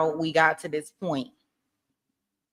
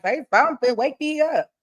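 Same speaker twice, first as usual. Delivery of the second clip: pleading wake-up call; 8 semitones higher, 3 dB louder than usual. Retold or imitated.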